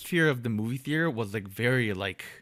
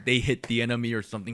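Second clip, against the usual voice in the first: usual speaking voice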